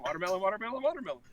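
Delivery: high, rhythmic mock crowd filler; background walla. Actor friend faking background talk, mock walla. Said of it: "or he's just saying".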